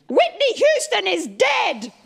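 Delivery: high, slow mock scream of the news; mum's all-caps text. Mum's caps-lock text announcing [name]'s death, high slow mock shriek. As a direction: wail it like a text in capitals. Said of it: "the text message screams at me".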